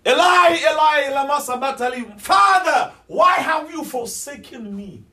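high, anguished full-voiced cry to God; screaming. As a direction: scream it out, stretching the final plea. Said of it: "Then he screamed".

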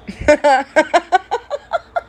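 high, bright fake customer laugh; fake serving laugh. Shown as a demonstration. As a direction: put on the laugh for tables. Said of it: "that laugh, fake".

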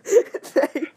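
laughing through the word. Real, not acted. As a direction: breaks into laughter. Laughing mid-story.

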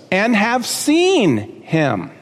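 seen drawn out slowly and held; slow, leaning on seen; stress on seen. Shown as a demonstration. Pastor pointing the congregation to the verse, slow stress on seen. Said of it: "Now look at this".

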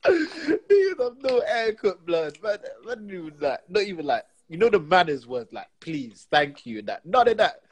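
laughing rant. Real, not acted. Outraged, laughing rant about manners.

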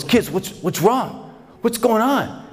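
high-pitched, urgent fatherly questions; alarmed questioning. Acted. Father to his huddled crying kids, alarmed. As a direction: demand answers in acted alarm.

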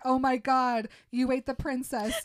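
loud, high mock outcry; retold aghast shout; horrified screaming. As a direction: yell it in hammed-up horror. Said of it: "he won't stop screaming".

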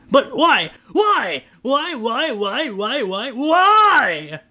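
high, drawn-out whine; whining. Acted. Trent whining at Kate as she leaves, high pleading whine. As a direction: whine like a heartbroken child. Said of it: "Trent whined".

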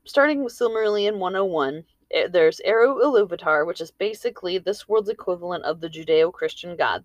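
ordinary delivery

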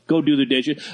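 ordinary talk

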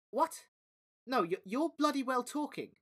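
can't believe he's being gaslit; indignant disbelief. Acted. Dom protesting to the pigeon, high and exasperated.

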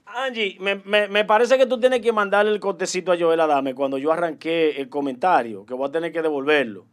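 quiet aside. Real, not acted. Panelist asks Angie for the clip, low quiet aside. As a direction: drop it right down, speaking off to a colleague.

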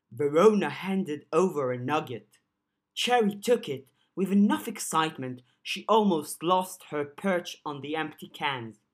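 ordinary delivery

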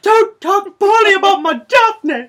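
high, slow, hammed-up protective yell; over-the-top mock wail. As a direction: holler it like a tearful, melodramatic defender. Escalated mock defense of friend.

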